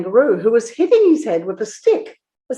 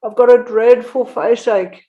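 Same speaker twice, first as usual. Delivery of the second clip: high, groaning bear's complaint; 5 semitones higher, 6 dB louder than usual. A narrator's reading. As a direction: moan it out in pain like a bear. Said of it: "groaned the bear".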